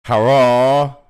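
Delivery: loud cheering hurrah. Real, not acted. Host opening the live stream, loud cheer.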